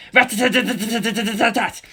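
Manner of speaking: rattling rat-a-tat gunfire imitation; mouth-made machine gun fire; machine gun sound. A narrator's reading. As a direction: spit out the gun burst.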